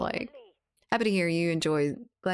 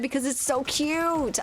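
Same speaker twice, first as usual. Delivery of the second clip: high-pitched gushing protest; cute-overload squeal; 9 semitones higher than usual. Pretend. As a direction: squeal it in playful self-defence. Defends crying over frog dances.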